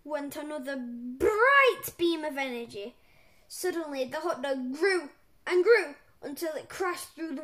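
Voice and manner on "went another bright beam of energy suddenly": ordinary delivery, nothing marked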